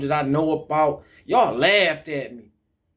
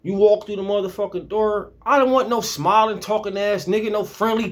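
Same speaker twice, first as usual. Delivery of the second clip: normal talking voice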